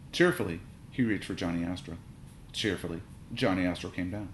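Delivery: plain reading voice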